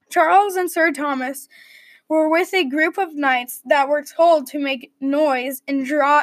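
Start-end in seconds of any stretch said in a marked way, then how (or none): none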